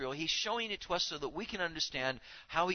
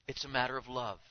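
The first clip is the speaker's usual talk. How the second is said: usual speaking voice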